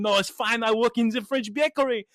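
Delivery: put-on light, reassuring French-accented English; French woman's accent. French woman explaining her bakery job, French accent. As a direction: imitate a Frenchwoman brushing off thanks, French accent. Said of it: "And French woman's going".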